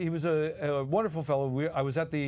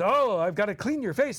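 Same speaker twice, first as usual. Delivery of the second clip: higher, louder, jolly storyteller voice; Dom DeLuise voice; 5 semitones higher, 4 dB louder than usual. Retold or imitated. Dom telling his Christmas story on air, jolly and high. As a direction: gush like an excited showman. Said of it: "Dom is working it".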